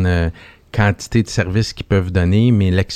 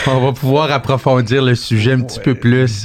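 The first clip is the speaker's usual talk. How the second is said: laughing; 5 semitones higher than usual. Real, not acted. Laughing at the 007 joke.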